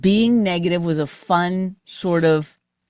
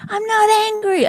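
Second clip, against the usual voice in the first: mock angry denial, pitched high; protesting husband imitation; 11 semitones higher than usual. Wife imitates husband denying his anger, high mock protest. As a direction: mimic him indignantly insisting, voice shooting up. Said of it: "it's always like".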